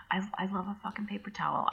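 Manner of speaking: hushed, breathy aside; whispered confession. Real, not acted. Guest confessing love of paper towels, whispered. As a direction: whisper it like a guilty secret.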